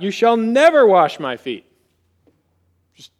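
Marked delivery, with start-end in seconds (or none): 0.0-1.6 sudden loud blurt, stress on never. Acted. Peter to Jesus, a sudden loud blurt. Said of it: "he blurts out".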